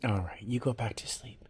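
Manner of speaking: soft unvoiced whisper. Real, not acted. Owner whispering her cat back to sleep, whisper.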